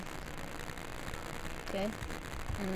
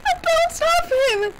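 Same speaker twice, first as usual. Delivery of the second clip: excited. Real, not acted. Excited gaming.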